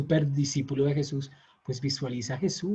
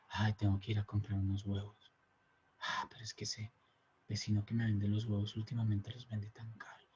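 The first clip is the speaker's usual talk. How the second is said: softer mock inner chatter, dipping to a whisper; wandering thought; 5 semitones lower, 12 dB quieter than usual. Teacher voicing the mind drifting during meditation, hushed chatter. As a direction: mutter your distracted grocery worries quietly. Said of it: "la mente se pone a pensar".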